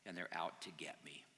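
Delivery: low, whispered resignation; hopeless. Acted. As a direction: let it sink into a defeated whisper. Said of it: "he felt really futile".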